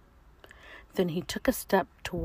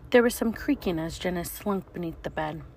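usual speaking voice

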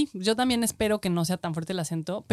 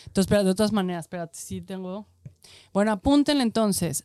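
usual speaking voice

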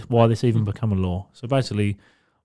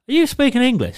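high-pitched mock disbelief; baffled incredulity; 12 semitones higher, 4 dB louder than usual. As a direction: act out total bafflement, pitched way up. Acting out his baffled reply.

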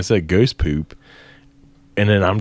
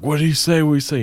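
frantic questions; 7 semitones higher, much faster than usual. Retold or imitated. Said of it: "He's like".

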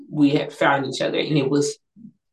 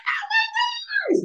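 very high, drawn-out squeal; freaking out; slower than usual. Retold or imitated. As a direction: replay the shock of being proposed to. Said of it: "like, freaking out".

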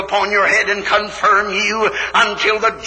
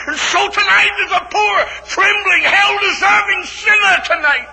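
fervent shout; 12 semitones higher than usual. Real, not acted. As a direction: pour it all out. Preaching climax.

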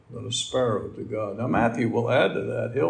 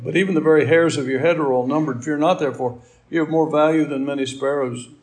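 a slightly louder, measured Bible reading; scripture reading; 4 dB louder than usual. Acted. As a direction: read the verses out like a lesson from the pulpit. Preacher reads the scripture passage.

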